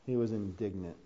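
low, soft and understated; quiet statement. Shown as a demonstration. Preacher first telling the congregation Jesus' reaction, low and quiet.

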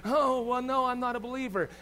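lightly hammed-up exasperation; mock frustrated. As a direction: act out a frustrated would-be believer. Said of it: "you're gonna get all frustrated".

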